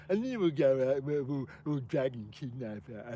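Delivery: mocking put-on voice of the gang members; imitating gang talk. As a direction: mimic the gang casually planning a night out. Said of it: "that's how, how they all talk".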